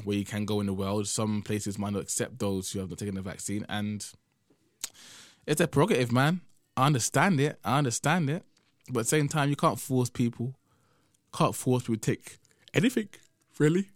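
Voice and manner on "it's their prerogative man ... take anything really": higher and louder, insisting; emphatic. Real, not acted. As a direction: press the point hard. Raised emphatic point.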